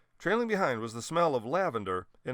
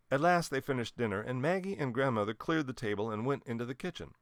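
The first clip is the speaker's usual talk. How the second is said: usual speaking voice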